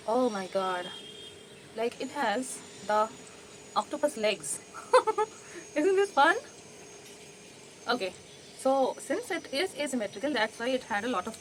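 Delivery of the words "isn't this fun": laughing. Real, not acted. Showing gross extracted tooth.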